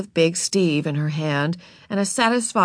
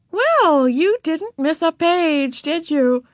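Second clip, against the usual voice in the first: high, slow librarian voice; librarian remarking; 11 semitones higher, 5 dB louder, slower than usual. A narrator's reading. Miss Evans remarking to Ramona on the pages, high and slow. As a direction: observe it slowly in a high voice. Librarian inspects scribbled book.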